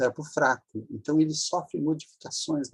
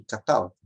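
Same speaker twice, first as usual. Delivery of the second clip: catalta with strong TAL, weak final ta; lower-pitched catalta, stressed on the middle syllable; 4 semitones lower than usual. Shown as a demonstration. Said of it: "a sílaba forte é essa aqui".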